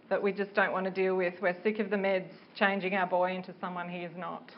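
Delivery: normal talking voice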